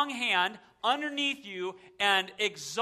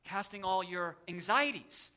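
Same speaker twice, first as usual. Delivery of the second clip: "anxieties" set apart and lifted after a quieter lead-in; stress on anxieties; 4 dB quieter than usual. Shown as a demonstration. Preacher showing the verse's different senses, stress on "anxieties". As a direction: lean on the word "anxieties". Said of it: "I like to emphasize different words".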